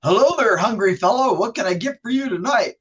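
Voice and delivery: loud, hearty clerk greeting; drive-through clerk. Acted. Host playing server greeting a hungry fellow, loud put-on clerk voice. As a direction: boom out a showy welcome, easing off toward the end. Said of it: "I'm using two different voices".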